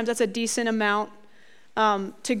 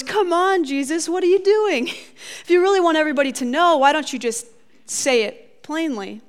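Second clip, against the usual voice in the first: exasperated; 5 semitones higher than usual. Retold or imitated. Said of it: "part of you kinda goes".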